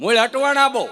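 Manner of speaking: punchline shout. Real, not acted. Punchline catchphrase to audience.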